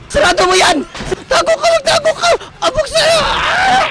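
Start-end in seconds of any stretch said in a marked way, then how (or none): 1.3-3.9 high, frantic cries; panicked squealing. Real, not acted. Player scrambling to hide from enemy, panicking. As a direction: squeal it in a fluster, ending in a scream.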